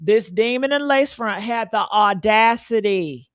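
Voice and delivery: ordinary talk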